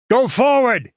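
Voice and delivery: high, loud urgent yell; officer blowing his cork. A narrator's reading. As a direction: yell the men forward. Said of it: "he yelled".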